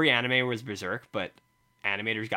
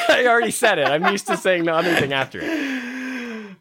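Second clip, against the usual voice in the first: laughing; 10 semitones higher, 8 dB louder than usual. Real, not acted. Flubbed the plug, laughing.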